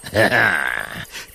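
low pleased chuckle; low lion chuckle; lion's chuckle. A narrator's reading. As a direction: laugh like a smug lion. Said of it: "Grinst der Löwe".

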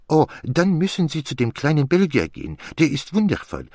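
high-pitched, French-accented mimicry; gushing admirer. Detective quoting his admirers to his friend, gushing in mimicry. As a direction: go high, mimicking an impressed client. Poirot acts out admiring clients.

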